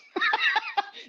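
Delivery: a burst of high laughter; short high laugh; real laugh. Real, not acted. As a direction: laugh at your own joke. Laughs at own joke.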